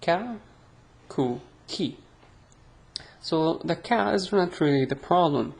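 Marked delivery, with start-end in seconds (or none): none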